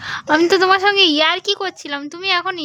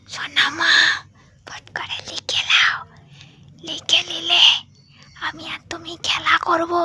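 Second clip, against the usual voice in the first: speaking softly. Real, not acted. Mother coaxes her little daughter to finish writing, whispering. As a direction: whisper it close and gentle. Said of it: "আস্তে করে বলবো।".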